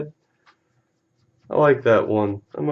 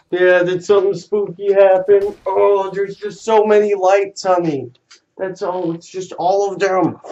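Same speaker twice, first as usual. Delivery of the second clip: soothing the dog; 6 semitones higher, 5 dB louder than usual. Real, not acted. Owner soothing his spooked dog with loud baby talk. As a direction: comfort her like a frightened puppy.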